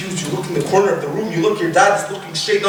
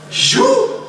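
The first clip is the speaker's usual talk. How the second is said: very high-pitched startled word; shocked question. Acted. Dying person facing the creature, shocked.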